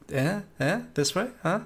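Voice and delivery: high coaxing calls to a dog. Pretend. Player coaxing the dog toward the tracks, high coaxing calls. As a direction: coax the dog along with questioning eh sounds. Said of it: "and be like".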